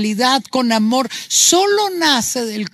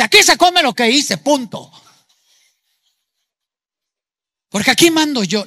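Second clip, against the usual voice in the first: bossy tyrant; 3 semitones higher, 4 dB louder than usual. Acted. Wounded tyrant parent ordering family.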